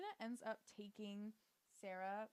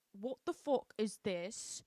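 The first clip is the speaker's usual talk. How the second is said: slow, mock-indignant British accent; 4 dB louder, slower than usual. Patricia finding Nina in Joy's spot, indignant British accent. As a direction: put on British, stretch each word. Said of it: "I keep doing that accent".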